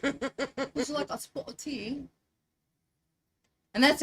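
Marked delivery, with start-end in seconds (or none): 0.0-2.0 slow, high, soft mock British; amateur British tea-time accent. Shown as a demonstration. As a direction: offer it daintily in put-on British. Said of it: "That's all I can do".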